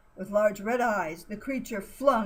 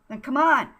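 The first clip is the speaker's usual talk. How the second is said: loud urgent push; insisting; 5 semitones higher, 9 dB louder than usual. Acted. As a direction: hurry them along firmly. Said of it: "Jan insisted".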